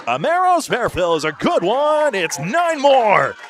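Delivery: high, loud sportscaster shout; big excited commentator call; excited play-by-play. Real, not acted. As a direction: belt out the call, drawing out the end. Announcer calls a big shot.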